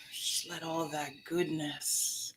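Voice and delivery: soft, slow whispered meditation voice; guided breathing. Acted.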